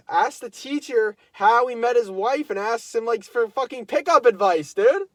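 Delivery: building to a loud, high, disbelieving rant; can't believe what he's hearing; incredulous outburst. Real, not acted. Telling his viewers, building into loud disbelief. Outraged retelling, rising.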